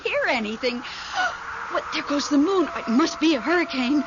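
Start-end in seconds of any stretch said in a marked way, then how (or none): 0.9-1.7 frightened gasp. Acted. Frightened by the hurricane.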